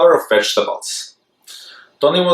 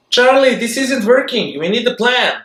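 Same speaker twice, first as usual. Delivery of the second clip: high piping voice; piped; 11 semitones higher than usual. Acted. Petunia pipes up to Charlie, high and urgent. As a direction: pipe it up high and bright. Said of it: "Petunia piped".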